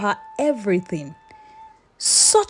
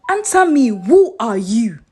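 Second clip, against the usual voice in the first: fierce demand; 5 dB louder than usual. Acted. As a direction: make him answer you now. Asabi demands again, fierce.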